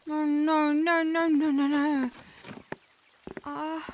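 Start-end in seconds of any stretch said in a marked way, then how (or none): 0.1-2.1 panicked no. Acted. Shop assistant panics over mixed-up boxes.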